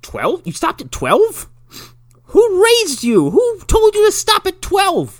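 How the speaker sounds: rising disbelief growing to a loud high yell; yelling at the girl's bad counting; incredulous. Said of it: "I'm like".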